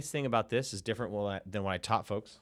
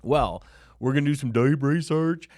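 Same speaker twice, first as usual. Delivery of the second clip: louder, higher hyped reply; hype announcer; 5 semitones higher, 9 dB louder than usual. Acted. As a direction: sell it like a show host. Hyped answer to own question.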